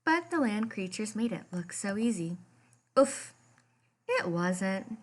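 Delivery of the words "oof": short pained oof as he stumbles; a clumsy first step, knocked out of him; mock grunt of effort. Kelp stumbling on new legs, lightly acted grunt. Kelp trying to walk on land.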